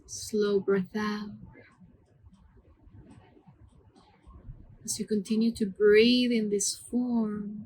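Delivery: calm, hushed meditation voice with long pauses; guided meditation. Acted. Yoga teacher leading students through mantra breathing, soothing guided meditation. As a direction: guide the breath gently, word by unhurried word.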